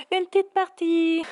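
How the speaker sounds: parle en croquant des chips; bouche pleine. Real, not acted. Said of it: "Excuse me, les chips". (en mangeant des chips)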